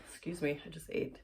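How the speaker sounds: usual speaking voice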